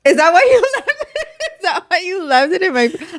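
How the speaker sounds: laughing high through the words. Real, not acted. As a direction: tease her while cracking up. Laughing teasing friend.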